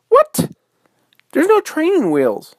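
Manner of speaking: high, loud squeal of disbelief; shocked little girl's outburst; astonished. Acted. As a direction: squeal it in sheer amazement. Girl astonished bear rides off.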